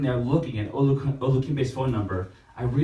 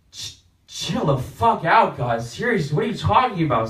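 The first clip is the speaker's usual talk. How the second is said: raised, incredulous protest; exasperated; 6 semitones higher, 5 dB louder than usual. Retold or imitated. Catholics telling God to calm down, exasperated. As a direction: push back, baffled.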